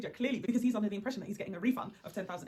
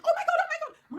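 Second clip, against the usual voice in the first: high shrieking panic; panicked scream; 9 dB louder than usual. Retold or imitated. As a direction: shriek it in terror.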